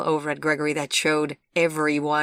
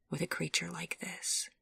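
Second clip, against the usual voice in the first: hushed, much softer whisper; 10 dB quieter than usual. A narrator's reading. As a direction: breathe it out like a private warning. Gregory's inner thought.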